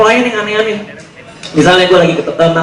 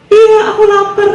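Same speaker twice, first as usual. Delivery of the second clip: high mock-girlfriend voice, much higher than his own; girly falsetto; 12 semitones higher than usual. Hungry girlfriend answering her boyfriend, in high mock falsetto. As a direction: squeak it like a whiny girlfriend.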